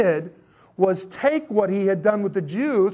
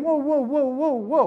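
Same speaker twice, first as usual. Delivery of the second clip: higher-pitched repeated halting call; imitated protest, pitched up; Peter objecting; 6 semitones higher than usual. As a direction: cut in to stop the argument, lightly acted. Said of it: "And Peter's saying".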